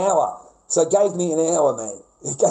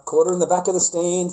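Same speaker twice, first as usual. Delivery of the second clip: normal talking voice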